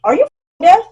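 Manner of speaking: fed up with a customer; exasperated outburst. Real, not acted. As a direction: blurt it out in disbelief. Ranting about customer.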